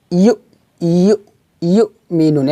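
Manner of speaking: "ইউ" cut short with a push; pushed syllable. Shown as a demonstration. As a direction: jolt the "ইউ" of "ইউমিনুন" to a stop. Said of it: "ধাক্কা দিব". ("ইউ"-তে ধাক্কা দিয়ে)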